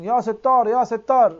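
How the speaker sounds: mock cry for God's help; retold shouting. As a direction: raise the voice like a crowd calling out. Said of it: "diye bağırıyorlar".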